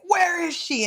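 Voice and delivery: high-pitched mock demand; demanding searcher. Retelling footsteps outside the door, mock demanding call. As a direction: call it out as if hunting her.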